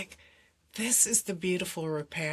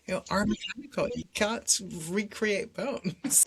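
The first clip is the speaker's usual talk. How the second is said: usual speaking voice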